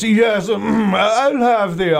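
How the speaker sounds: high, slow, affected ordering voice; put-on indulgent diner. Diner ordering at the fancy restaurant, hammed-up luxuriating. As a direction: drag it out like a pompous epicure. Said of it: "have to talk in that voice".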